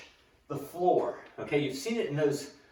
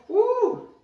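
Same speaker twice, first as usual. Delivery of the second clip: high, loud excited woo; excited whoop; 7 dB louder than usual. Real, not acted. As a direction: whoop with surprise.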